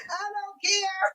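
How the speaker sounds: high, soft and slow through laughter; laughing defiance. Real, not acted. Host defending mismatched socks to viewers, laughing. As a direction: wheeze it out, still cracking up.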